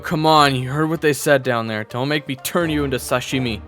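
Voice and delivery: ordinary delivery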